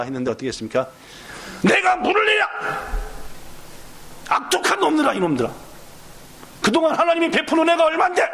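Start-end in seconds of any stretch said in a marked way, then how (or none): 1.6-8.2 angry Moses. Acted. Pastor voicing Moses rebuking the Israelites, furious and high. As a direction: lash out at the rebellious people.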